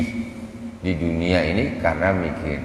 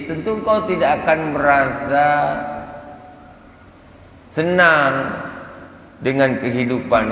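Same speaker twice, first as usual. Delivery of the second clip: higher, louder, very slow rebuke; slow stern admonition; 9 semitones higher, 3 dB louder, slower than usual. Retold or imitated. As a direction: drag out each word like a stern warning. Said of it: "kata Sayyidah Rabi'ah".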